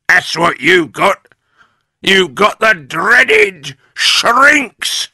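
crowing character voice, climbing in pitch; Mr Twit. A narrator's reading. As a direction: build to a slow, triumphant shout. Mister Twit torments his wife.

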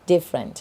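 "different" said DIF-rent, three clean syllables; correct pronunciation. Shown as a demonstration. Teacher modelling "different" with clear stress on DIF. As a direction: say "different" slowly as DIF-frent, not dif-fe-len. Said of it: "อ่านว่า different".

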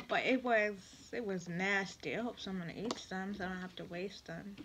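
ordinary delivery

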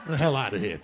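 normal talking voice

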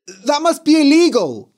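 high, loud indignant shout. Acted. Tobias shouting at his parents through the door. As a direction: shout it in outrage. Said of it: "he shouted".

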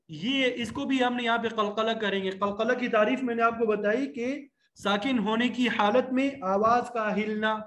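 ordinary delivery

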